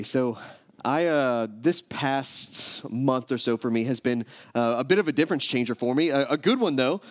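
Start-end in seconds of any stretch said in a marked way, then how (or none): none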